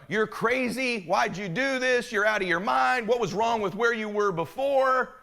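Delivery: high, drawn-out imitation of naysayers; mock disbelief. Everyone he knows questioning the purchase, high mock disbelief.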